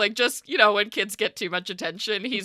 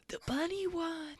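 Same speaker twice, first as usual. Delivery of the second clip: put-on hushed kid; 10 semitones higher, 12 dB quieter than usual. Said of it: "everybody's just whispering".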